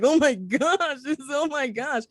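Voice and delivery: crying. Retold or imitated. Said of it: "I'm like".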